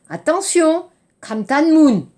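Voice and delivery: usual speaking voice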